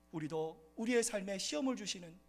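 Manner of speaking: ordinary talk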